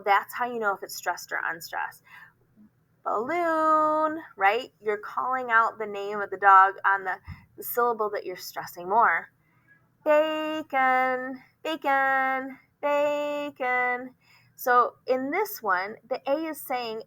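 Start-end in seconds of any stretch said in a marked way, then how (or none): 3.0-4.2 BALLOON called out high, LOON held long; high sustained second syllable in BALLOON; calling a dog. Shown as a demonstration. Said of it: "calling that word out like you're calling your dog". 10.1-14.1 BACON called out high, BAY drawn long; high, sustained calls of BACON; calling a dog. Shown as a demonstration. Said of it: "like you're calling your dog".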